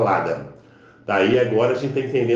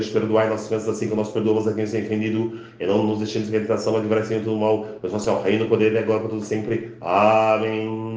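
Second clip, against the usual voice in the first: hurried shortened recitation; fast, clipped prayer; much faster than usual. Shown as a demonstration. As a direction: rattle the Our Father off quickly. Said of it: "Acelerar e encurtar".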